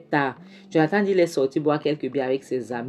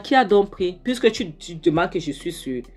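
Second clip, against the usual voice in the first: angry demand; 3 semitones higher, 4 dB louder than usual. Retold or imitated. Aunt confronting Thérèse about the stolen money, angrily. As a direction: press her hard for an answer.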